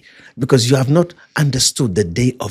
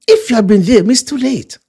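high-pitched, emphatic complaint; acted-out lament; 11 semitones higher, 5 dB louder than usual. Sister telling Jesus he came too late, high-pitched complaint. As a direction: complain in a raised preacher's re-enactment.

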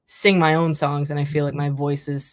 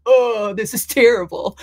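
mock despair; 7 semitones higher, 5 dB louder than usual. Retold dismay after audition rejection.

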